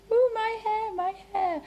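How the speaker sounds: high, fussy hair-preening voice; a playful diva fussing over her hair; mock vanity. To the viewers, mock-preening her hair in a high voice.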